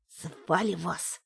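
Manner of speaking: soft slow whisper. Acted. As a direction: hiss it quietly and slowly. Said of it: "зашептал Тимка".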